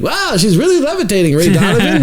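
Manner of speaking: high, hammed-up kid wonder; showman's amazement. Young showman selling the trick to the crowd, hammed-up amazement. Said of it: "I was a showman".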